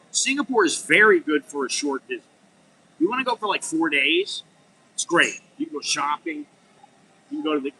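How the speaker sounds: usual speaking voice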